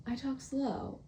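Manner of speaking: "slow" drawn out, softly exaggerated; talking slow. Shown as a demonstration. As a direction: lean into a soft, lingering "I talk slow". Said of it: "when I talk slow, it's like".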